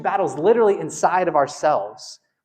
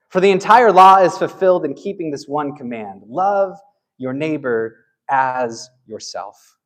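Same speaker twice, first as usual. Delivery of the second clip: louder, measured reading of the verses; scripture reading; 4 dB louder than usual. Acted. Pastor reading Galatians to the church, solemn reading. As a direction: read the passage with weight and slow endings.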